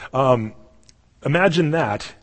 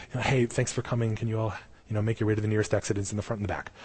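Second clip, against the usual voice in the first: mock polite usher; 3 semitones lower, 7 dB quieter, faster than usual. Mock polite usher dismissing mourners.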